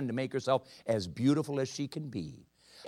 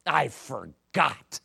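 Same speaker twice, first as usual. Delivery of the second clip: very slow, drawn-out sheepish reply; a slow guilty confession; sheepish admission; much slower than usual. Retold or imitated. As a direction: stretch it out, embarrassed.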